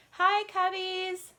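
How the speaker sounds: normal talking voice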